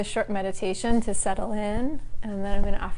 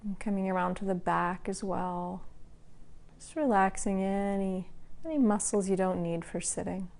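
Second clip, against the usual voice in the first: calm, even voice with long pauses between phrases; guided meditation. Acted. Teacher guiding the class to relax part by part, calm meditation voice. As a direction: lead a body scan, unhurried and level. Leads a body-scan meditation.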